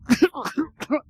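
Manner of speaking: loud, high hacking cough; explosive mock cough; imitated choking cough. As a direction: mimic a violent coughing fit. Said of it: "he starts to cough in this way".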